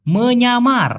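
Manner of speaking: careful Malay word; Malay pronunciation. Shown as a demonstration. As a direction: say মন্যামার with the Malay sound. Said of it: "সেটা হচ্ছে".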